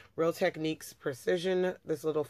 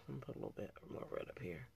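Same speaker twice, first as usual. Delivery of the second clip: whispered aside; 5 semitones lower, 17 dB quieter, much faster than usual. Real, not acted. Muttered aside while applying makeup.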